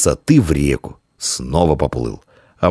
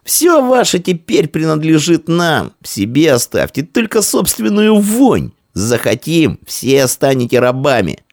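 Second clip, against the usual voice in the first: high, heated gloating; scornful shouting; 13 semitones higher, 3 dB louder than usual. Acted. Victor's boy threatening Roman boy with slavery, shouting scornfully. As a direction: jeer at the defeated, pitched high.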